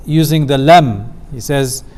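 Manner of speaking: ordinary talk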